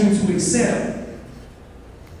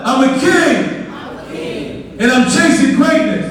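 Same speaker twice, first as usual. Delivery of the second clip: raised, drawn-out rallying yell; 5 semitones higher, much slower than usual. Shown as a demonstration. Speaker leads audience affirmation, yelling it out. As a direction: yell it higher for a crowd to echo. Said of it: "Yell it out".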